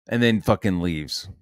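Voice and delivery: ordinary talk, nothing marked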